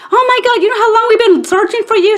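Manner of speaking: very high, loud and thrilled; overexcited spiel. Retold or imitated. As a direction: gush loudly at finding a long-lost sister. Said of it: "went into this whole spiel".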